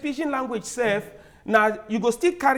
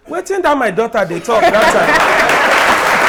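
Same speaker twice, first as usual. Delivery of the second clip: slow, loud, laughing market woman; mock a puzzled market mama; laughing imitation of a confused old trader; market mama asking; 9 dB louder, slower than usual. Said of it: "mama wey dey sell for market go dey ask say".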